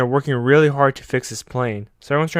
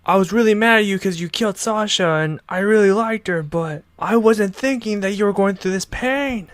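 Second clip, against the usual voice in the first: much higher, earnest confession; confessing Connie; 8 semitones higher than usual. Retold or imitated. Connie confessing to Eren, much higher and earnest. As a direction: open up about the grudge. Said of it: "Connie tells him".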